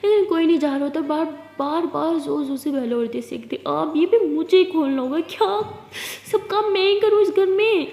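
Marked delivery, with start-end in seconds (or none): 3.7-7.9 higher, louder fed-up complaint; fed-up shout at unanswered doorbell; irritated yell. Acted. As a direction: vent at the whole house. Annoyed at ringing doorbell.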